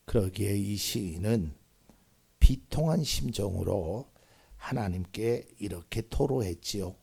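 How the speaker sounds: ordinary delivery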